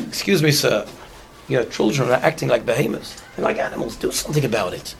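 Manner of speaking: low, hushed rebuke to a stranger; hushed complaint. Retold or imitated. Passenger quietly scolds the father on the train, hushed rebuke. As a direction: quietly scold the father, dropping to a near whisper.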